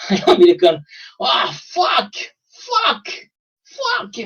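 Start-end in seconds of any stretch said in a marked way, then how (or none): 1.2-4.2 squeaky rising high cursing, much higher; American reacting in mock shock, rising squeaky curses. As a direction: curse in a climbing falsetto.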